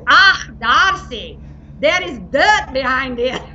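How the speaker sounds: put-on slow, prim scolding old lady voice; German grandmother. Grandmother scolding Darcy about dirt, slow and prim. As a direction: drag out the Ah, disapproving. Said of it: "she pulls out my refrigerator and she says".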